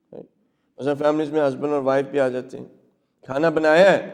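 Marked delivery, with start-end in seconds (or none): 3.3-4.0 curt, pressing question on बनाया; brusque husband's demand. Shown as a demonstration. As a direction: demand it like an accusation. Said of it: "the first way of communication".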